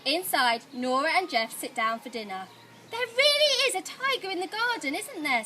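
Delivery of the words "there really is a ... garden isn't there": higher, fast eager question; a mild thrill of a secret; excited. A narrator's reading. Nora asks Grandma about the tiger, mildly excited. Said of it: "says Nora".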